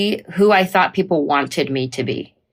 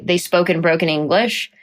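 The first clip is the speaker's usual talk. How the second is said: normal talking voice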